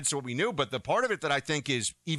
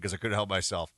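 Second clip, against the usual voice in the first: normal talking voice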